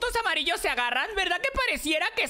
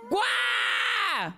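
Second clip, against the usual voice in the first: demonstrated wow shout. Shown as a demonstration. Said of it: "Tienes que decir, no sé". (guau gritado de demostración)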